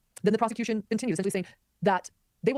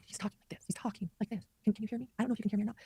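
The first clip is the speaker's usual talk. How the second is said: imitating a soft-spoken defendant, nearly whispering; barely audible; 3 semitones higher, 7 dB quieter, faster than usual. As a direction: drop to a faint, hushed mock mumble into the mic. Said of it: "That's how he's talking".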